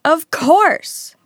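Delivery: high, loud exclamation. A narrator's reading. Harry staring at Hermione, loud realisation. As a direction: burst out with dawning certainty. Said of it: "said Harry loudly".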